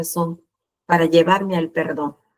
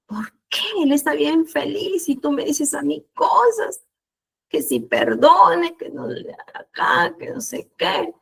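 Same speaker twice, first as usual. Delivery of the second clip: aguda, quejosa y exasperada; queja a Dios; 6 semitones higher, slower than usual. Retold or imitated. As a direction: protesta como niña ofendida. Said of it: "yo le decía eso a Dios". (quejándose ante Dios, agudo y exasperado)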